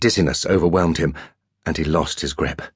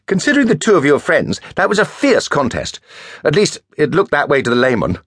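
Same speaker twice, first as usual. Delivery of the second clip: much higher, louder languid schoolboy; Perry's voice; 9 semitones higher, 3 dB louder than usual. A narrator's reading. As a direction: lift into a lazy, teasing toff. Perry's high character voice.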